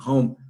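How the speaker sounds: one-syllable "home", lips closing on the M; home ending in M. Shown as a demonstration. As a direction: end "home" on M with no added vowel. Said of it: "acaba com M". ("home" numa sílaba só, terminando em M)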